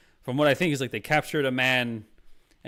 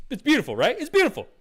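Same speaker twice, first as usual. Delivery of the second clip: much higher, louder squeal of approval; high-pitched gushing; 13 semitones higher, 6 dB louder, faster than usual. Real, not acted. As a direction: leap up into a high, loud voice. Gushing, pitch leaps far up.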